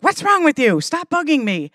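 put-on high, loud exasperated snap at the kid; mock frustrated mom scolding; imitating an annoyed mother in the grocery line; irritated snap. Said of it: "she'd be like".

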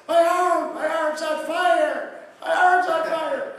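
high, loud, slow wail of pain; hammed-up screaming patient; mock panicked scream. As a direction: cry out like your arm is burning. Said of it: "he started screaming".

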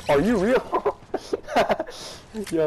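high-pitched, breaking into laughter; incredulous laughing. Real, not acted. Gamer laughing at a terrible opponent, incredulous. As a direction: crack up in disbelief.

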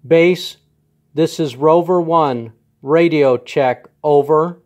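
loud, crisp radio procedure call. Real, not acted. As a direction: call it into the handset, loud and formal. Making a radio call to son.

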